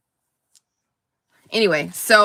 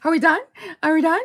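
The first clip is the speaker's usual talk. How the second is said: high impatient Barbie voice; mock Barbie tour voice; imitating Barbie; 9 semitones higher, faster than usual. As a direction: chirp it like a bored tour guide. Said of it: "she's like".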